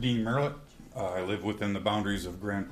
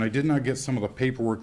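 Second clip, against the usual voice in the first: normal talking voice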